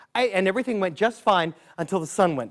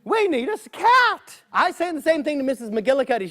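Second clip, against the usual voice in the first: pleading townsperson; 8 semitones higher than usual. Acted.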